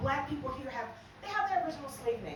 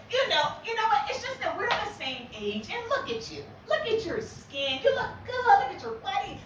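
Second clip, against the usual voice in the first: gushing friend; 9 semitones higher, 5 dB louder than usual. Retold or imitated. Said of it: "she says".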